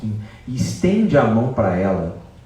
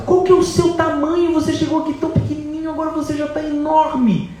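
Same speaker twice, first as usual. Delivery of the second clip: much higher, wondering voice; amazed; 3 dB louder than usual. Acted. Sita asking Hanuman his size, high and amazed. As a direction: marvel at how big he got.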